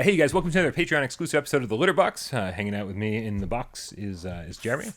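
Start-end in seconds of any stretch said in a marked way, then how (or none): 0.0-2.3 higher, louder, fast host intro; show intro. Acted. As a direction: announce the episode brightly. Podcast intro announcement.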